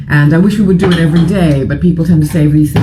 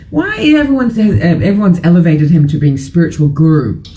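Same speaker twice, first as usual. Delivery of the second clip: usual speaking voice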